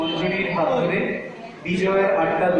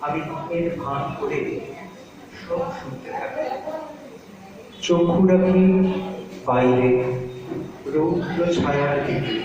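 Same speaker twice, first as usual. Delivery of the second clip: reflective poem recitation; 2 semitones lower than usual. Acted. Child feigning sleep listens and gazes out, pensive recitation.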